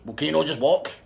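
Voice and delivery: loud, high, impatient retort; acted exasperation. Man refuses his partner taxi money, loud exasperation. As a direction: throw the question back loudly.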